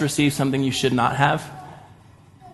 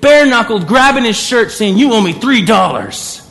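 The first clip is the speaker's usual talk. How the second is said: loud, high, outraged scolding; furious rebuke; 7 semitones higher, 6 dB louder than usual. Retold or imitated. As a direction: tear into him for his hypocrisy. Collector furious at the forgiven man.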